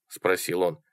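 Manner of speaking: usual speaking voice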